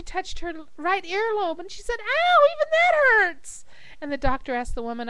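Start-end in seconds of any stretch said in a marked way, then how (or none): 2.0-3.6 very high, slow mock wail; hammed-up cry of pain; drawn-out pained wail. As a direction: stretch it out, high and hurting. Joke patient's last yelp.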